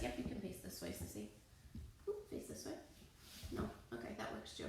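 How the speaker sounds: near-whispered, very soft aside; hushed aside. Real, not acted. Teacher redirecting a child mid-class, hushed aside.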